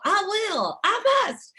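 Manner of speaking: imitating the whole seminar chanting; crowd yell. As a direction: shout it back like thousands at a seminar. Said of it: "we would all yell".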